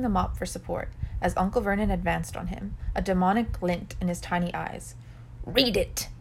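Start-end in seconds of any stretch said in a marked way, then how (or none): 5.4-6.1 evil hiss. A narrator's reading. Uncle Vernon brandishes the letter, evil hiss. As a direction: order it with a touch of evil. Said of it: "he hissed he-, evilly".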